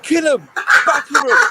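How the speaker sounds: high, loud screaming at the players; egging them on in a frenzy; hammed-up stadium roar; bloodthirsty fan yelling. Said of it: "I was like".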